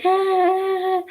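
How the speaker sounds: fake playing along.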